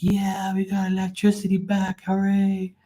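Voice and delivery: high, excited cheering; cheering crowd. Retold or imitated. Neighbours celebrating the electricity returning, high cheering. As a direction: celebrate like the whole street. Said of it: "Everybody's like".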